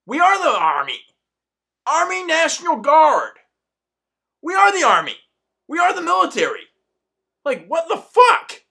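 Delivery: high, loud exasperated yell; retold outburst. Yelling at the coworker who called her liberal. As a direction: shout it at the coworker, fed up. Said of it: "I was like".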